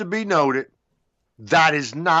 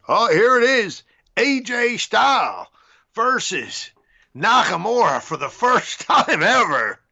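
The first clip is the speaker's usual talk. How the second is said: mock WWE announcer, louder and higher, breaking into a laugh; hype announcer; 6 semitones higher, 3 dB louder than usual. Sending up WWE's first-time-ever hype, mock announcer. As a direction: ham up the big match call, laughing through it. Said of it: "and they would say".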